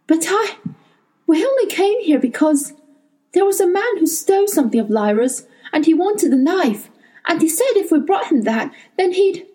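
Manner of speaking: stumbling to explain, words breaking off; flustered boy. A narrator's reading. Will struggles for words.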